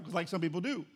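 ordinary talk, nothing marked

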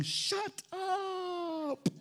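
high, soft, long-stretched command; drawn-out rebuke. Acted. Preacher capping his rebuke of grumblers, long high wail. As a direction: stretch the last word out high and thin.

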